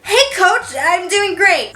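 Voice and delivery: high-pitched, awkward hello; awkwardly chirpy greeting. Retold or imitated. Student blurts a reply to the teacher in passing, awkwardly chirpy. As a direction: greet him far too brightly, voice jumping up.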